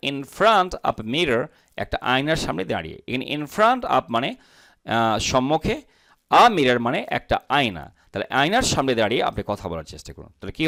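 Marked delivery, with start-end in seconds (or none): none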